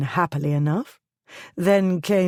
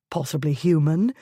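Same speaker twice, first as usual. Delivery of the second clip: plain reading voice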